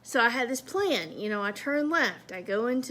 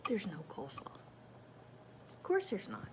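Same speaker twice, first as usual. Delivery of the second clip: whispered dismay; 4 semitones lower, 11 dB quieter than usual. Retold or imitated. Finding the bag has no coleslaw, a slow whisper.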